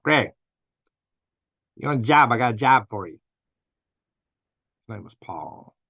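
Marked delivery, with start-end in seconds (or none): 0.0-3.2 a lightly imitated boss on the phone; boss's way of talking. Boss calling the worker with a job, lightly imitated. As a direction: give it the boss's blunt offer. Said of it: "that's the way he talked".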